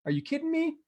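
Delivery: high squeaky mock shock; shocked disbelief. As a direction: act out stunned disbelief at bad news. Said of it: "she's like".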